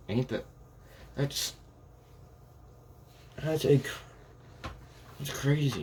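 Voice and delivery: amazed disbelief. Retold or imitated. Character amazed seeing spider blood.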